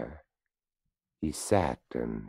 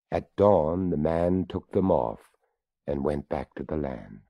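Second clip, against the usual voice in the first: ordinary delivery, nothing marked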